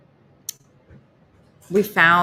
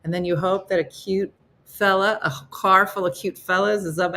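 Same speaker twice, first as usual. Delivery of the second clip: ordinary delivery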